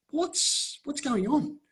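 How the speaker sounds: high, breathy confused asking; retold baffled query, pitched high; bewildered question. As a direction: sound lost and thrown, pitching way up. Confused, asking the tour guide.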